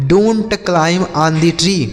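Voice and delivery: slow, careful English question; slow model reading. Shown as a demonstration. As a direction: read it out slowly for learners. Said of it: "slow model sentence".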